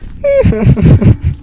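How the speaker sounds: squeaky nervous giggle; nervous high laugh. Real, not acted.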